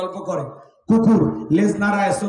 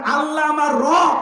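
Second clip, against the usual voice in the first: raised, slow declaration; proclaimed creed; 7 semitones higher than usual. Real, not acted. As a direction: call it out with full force. Preacher proclaims creed loudly. (জোরালো ঘোষণা)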